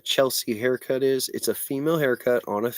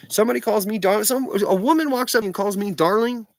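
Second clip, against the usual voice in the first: indignant; 8 semitones higher than usual. Real, not acted. Indignant rant.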